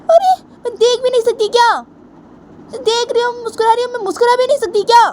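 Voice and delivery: put-on squeaky high girlish protest; childish protest. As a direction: protest your innocence like a sulky girl. Bani protests innocence, high voice.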